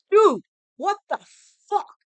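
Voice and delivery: high, loud angry yell down the phone; furious shout. Retold or imitated. Cop friend yelling at her over the phone, loud shout. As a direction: blast him with disbelief.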